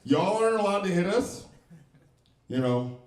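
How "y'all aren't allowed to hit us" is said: acting out baffled kids, rising to higher and louder; puzzled kid finding out the rules are different; confused disbelief.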